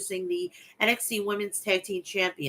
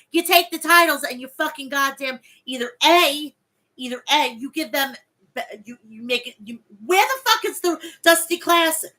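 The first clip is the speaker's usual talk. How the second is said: loud, higher, heated shouting rant; angry rant; 5 semitones higher, 5 dB louder than usual. Real, not acted. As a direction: vent at the booker, fired up. Ranting at Vince McMahon about titles.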